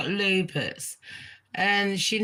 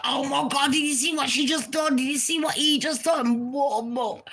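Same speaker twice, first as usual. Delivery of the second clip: gossipy shock; 8 semitones higher than usual. Retold or imitated.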